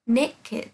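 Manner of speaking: "naked" in two syllables, ending in -id; careful teacher's "naked", NAY-kid. Shown as a demonstration. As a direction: give the ED of "naked" its own short syllable. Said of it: "sẽ được phát âm là E D".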